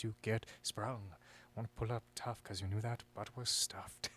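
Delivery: low, soft sinister villain rap. Acted. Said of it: "Sing a song in your villain voice".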